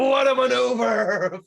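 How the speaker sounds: high, slow, drawn-out announcer cry; mock wrestling commentator. Commentator marvelling at the baby's headbutt, mock excited cry. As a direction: stretch it into a hammed-up commentator's exclamation.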